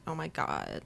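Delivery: Oh my God dropped low and quiet into creaky fry; vocal fry. Shown as a demonstration. Showing her co-host what vocal fry sounds like, low quiet creak. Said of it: "it's called vocal fry".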